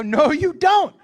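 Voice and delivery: high, loud shutdown; heated contradiction. Retold or imitated. Dad arguing with his son, exasperated shout.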